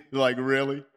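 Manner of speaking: low chuckle into a doubting question; disbelieving chuckle. Acted. Preacher questioning God's command, chuckling in disbelief. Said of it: "Sometimes you just have to chuckle".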